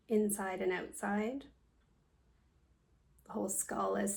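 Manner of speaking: guided meditation. Acted. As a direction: guide them calmly through a body scan, leaving space after each phrase. Leads a yoga nidra meditation.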